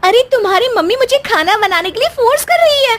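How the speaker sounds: high, fast whining protest; wife complains to husband in a high, fast whine; whiny complaint. Acted. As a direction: tattle on her to your husband, quickly.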